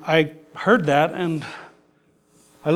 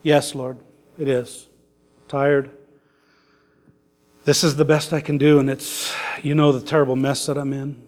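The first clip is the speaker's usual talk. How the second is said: weary prayer; 4 semitones lower, faster than usual. Retold or imitated. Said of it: "I just responded".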